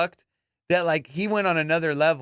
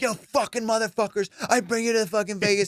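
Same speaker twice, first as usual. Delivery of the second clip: retold angry rant; 5 semitones higher than usual. Retold friend's angry rant about room.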